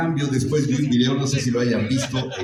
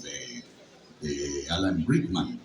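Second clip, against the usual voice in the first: ordinary delivery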